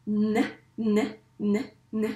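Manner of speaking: N as a short isolated "nn" sound; letter sound N. Shown as a demonstration. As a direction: say N by its sound, not the letter name. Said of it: "se prononce". (N prononcé « nnn », le son isolé)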